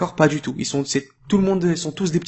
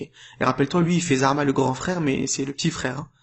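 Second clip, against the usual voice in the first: ordinary delivery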